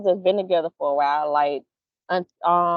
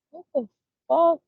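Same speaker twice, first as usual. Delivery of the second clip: shocked; 7 semitones higher than usual. Retold or imitated. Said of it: "And I be like".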